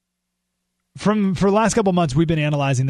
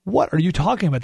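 usual speaking voice

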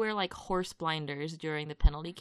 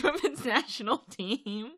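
laughing; 5 semitones higher, 6 dB louder, slower than usual. Real, not acted. Laughs mid-sentence.

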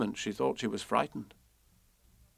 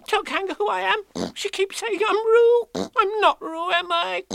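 squeaky pleading Piglet; 7 dB louder, slower than usual. A narrator's reading.

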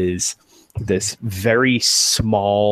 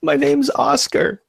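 very high, timid squeak; frightened answer; 3 dB louder than usual. Acted. Scared worker gives his name to the intruder, frightened.

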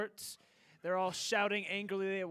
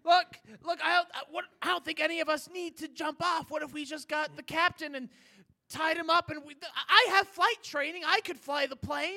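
higher, louder urgent plea; panicked pleading; 6 semitones higher, 4 dB louder than usual. Acted. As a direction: talk fast like you might die.

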